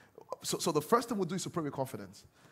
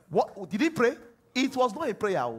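usual speaking voice

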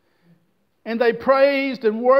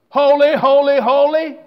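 loud, called holy, holy, holy; crying out holy; 3 semitones higher, 7 dB louder than usual. Acted. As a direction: proclaim it like stones crying. Rocks crying out praise.

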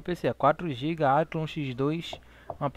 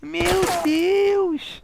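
much higher, much louder angry yelling; furious screaming; 15 dB louder than usual. Real, not acted. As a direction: scream at him in outrage. Raging at teammate's mistake.

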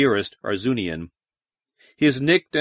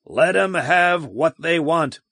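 rallying shout; 4 semitones higher than usual. Acted. Commander rallying men before charge.

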